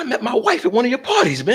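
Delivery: mock gush, squeaky high and quick; hammed-up high fanboy voice. Gang cop gushing over host.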